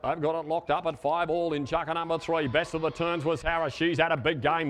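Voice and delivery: ordinary talk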